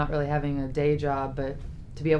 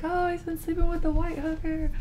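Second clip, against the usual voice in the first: high, mocking put-on voice of the gossips; mocking quote; 13 semitones higher than usual. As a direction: squeak it out as a teasing imitation. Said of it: "they're like".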